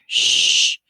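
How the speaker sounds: long, loud shush; forceful unvoiced shh; hushing. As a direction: hush the intruder firmly. Mother hushes the soldier.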